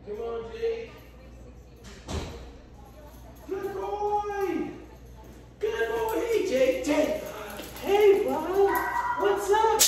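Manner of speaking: high, sing-song dog-praise voice; squeaky praising a dog. Real, not acted. Trainer talks to dog Jake.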